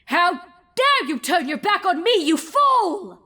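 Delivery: high, loud furious shout at her foe. Acted. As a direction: roar it down at the defiant pony. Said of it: "she shouted".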